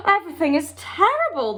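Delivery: a worried foreign king; King of Sweden answering in distress. A narrator's reading.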